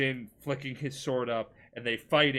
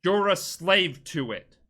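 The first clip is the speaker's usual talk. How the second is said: slow, louder, higher acted retort; retold defiant retort; 5 semitones higher, 7 dB louder, slower than usual. Jin answers his uncle before the duel, defiant. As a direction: draw it out forcefully, dramatically.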